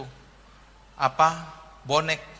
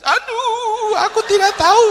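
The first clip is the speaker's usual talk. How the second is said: mock crying; 10 dB louder, slower than usual. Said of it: "Malah Tuhan yang nangis".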